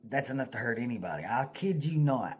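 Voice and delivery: ordinary delivery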